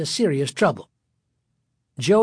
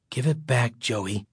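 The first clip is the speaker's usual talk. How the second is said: low, resigned plea; weary sigh; 4 semitones lower, 5 dB quieter than usual. A narrator's reading. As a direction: say it softly on a sigh, sinking on the name. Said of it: "Greg said with a sigh".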